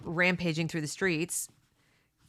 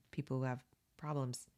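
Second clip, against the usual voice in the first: whispered trailing end; 4 semitones lower, 10 dB quieter than usual. Real, not acted. Host on kids mocking the weak, whispered. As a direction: drop to a whisper.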